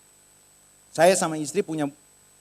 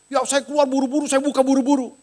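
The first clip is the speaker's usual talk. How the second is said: high, hurried-sounding reply; flustered maid; 8 semitones higher, 4 dB louder than usual. Retold or imitated. Maid explaining how she rushed out, flustered.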